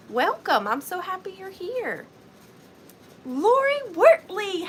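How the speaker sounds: ordinary talk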